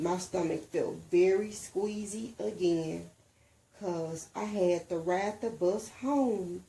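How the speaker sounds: usual speaking voice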